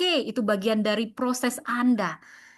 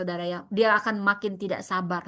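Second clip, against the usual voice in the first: normal talking voice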